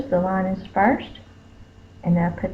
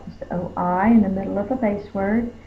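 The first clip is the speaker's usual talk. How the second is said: ordinary talk, nothing marked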